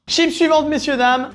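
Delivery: high, loud host announcement; showman announcement. Acted. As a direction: present it like a game show host. Announcing next chips, high and loud.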